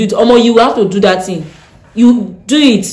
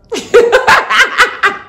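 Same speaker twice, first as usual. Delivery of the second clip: high laugh; 5 dB louder than usual. Real, not acted. Laughing at the threat.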